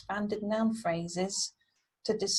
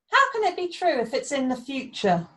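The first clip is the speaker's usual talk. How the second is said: high, raised-voice challenge; calling out; 6 semitones higher, 5 dB louder than usual. A narrator's reading. Beowulf calls out to the storyteller, high questioning call. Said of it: "called Beowulf".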